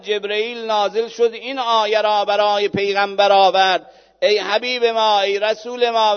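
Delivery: loud, high sermon climax; preaching at full force. Acted. Preacher at full force, Quran story. (موعظه با صدای بلند و پرشور)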